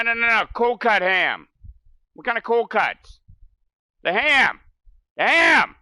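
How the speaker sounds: high, loud New Jersey deli-counter ranting; New Jersey accent. Retold or imitated. New Jersey accent, mocking deli regulars. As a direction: play the New Jersey cold cut guy. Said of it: "the accent".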